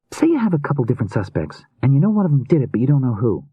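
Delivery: put-on high, girlish voice, probing; inquisitive young woman. A narrator's reading. Rune begins questioning Sam about suspects, inquisitive young woman. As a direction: pitch it high, fishing casually for information.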